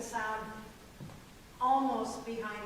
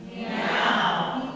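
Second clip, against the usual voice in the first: loud meow placed up front; loud forward meow; 8 dB louder than usual. Shown as a demonstration. Coach showing forward placement to chorus, meowing. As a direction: meow right up front, bright. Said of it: "I can be right up front".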